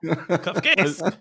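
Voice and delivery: "Kafkaesque" drawn out slowly through a laugh; slow, laughing repetition of "Kafkaesque"; laughing echo. Real, not acted. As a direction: repeat "Kafkaesque" while cracking up. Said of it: "a Kafkaesque nightmare".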